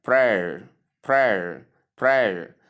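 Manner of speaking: prayer as one syllable rhyming with air; prayer as P-R plus the diphthong "air"; correct pronunciation. Shown as a demonstration. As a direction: model prayer slowly, PRAIR, like wear. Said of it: "So we pronounce it as".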